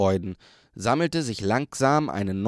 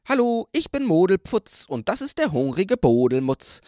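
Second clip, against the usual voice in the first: higher, louder eager sprite; forest spirit voice; 8 semitones higher, 4 dB louder than usual. A narrator's reading. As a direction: greet like a cheerful little spirit. Another forest spirit speaks.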